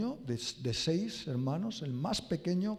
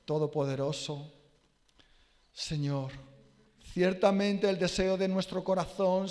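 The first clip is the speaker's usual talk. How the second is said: earnest spoken prayer to the Lord; congregation's closing prayer, earnest; 5 semitones higher, 7 dB louder than usual. Acted. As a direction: pray it with fervour and humility. Closing prayer after sermon.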